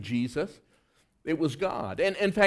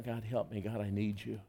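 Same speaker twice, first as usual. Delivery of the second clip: soft, pleading prayer to God; a hushed, earnest cry for help; quiet prayer; 11 semitones lower, 13 dB quieter than usual. Retold or imitated. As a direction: plead softly, as if alone with God. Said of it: "I just cry. Say".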